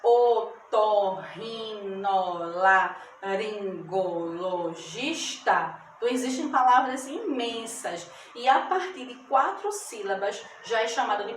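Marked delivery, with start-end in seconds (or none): 0.0-5.8 o-tor-ri-no-la-rin-go-lo-gis-ta, very slow separate beats; split into syllables. Shown as a demonstration. Teacher showing pupils ten syllables, each slowly separated. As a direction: count out otorrinolaringologista syllable by syllable. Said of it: "São dez sílabas".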